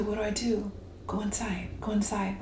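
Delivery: very soft, near-whispered guidance; quiet murmured instruction; hushed inner voice. Acted. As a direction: drop to a hush, like an inner prompt. Universe's whispered answer acted out.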